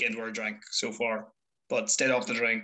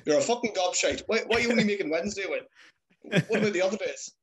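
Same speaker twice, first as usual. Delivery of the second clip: higher-pitched, exasperated mock rant; scolding dad; 6 semitones higher, faster than usual. Son imitating his dad grilling him about videos, exasperated. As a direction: imitate a father demanding answers.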